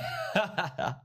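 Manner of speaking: a short high laugh at the pump; amused laugh. Real, not acted. Vlogger to viewers after the chart pumps, laughing. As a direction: laugh at how hard it ran.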